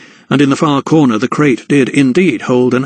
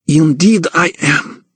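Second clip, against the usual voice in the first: put-on slow, higher accented count, lofty; haughty; 4 semitones higher, slower than usual. A narrator's reading. Count answers the crookback, haughty. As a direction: look down your nose. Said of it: "he replied haughtily".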